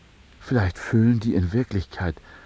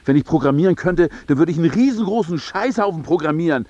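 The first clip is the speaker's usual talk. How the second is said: laut und höher werdend; wütender Ausbruch; 11 semitones higher, 6 dB louder than usual. Acted. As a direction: sich in Rage reden. Steigert sich in Wut. (wütend losschimpfen)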